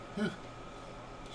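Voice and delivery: high-pitched silent wheeze of laughter; a breathless high giggle fit; high wheezing laugh. Acted. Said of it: "bent in half with laughter".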